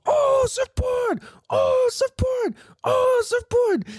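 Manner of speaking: put-on very high, slow, squealing little-kid shout; toddler squeal. Dad imitating his toddler son spotting surfboards, high squealing shout. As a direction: squeal it like an overjoyed three-year-old spotting a surfboard. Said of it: "He's going".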